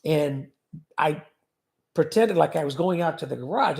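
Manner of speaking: ordinary talk, nothing marked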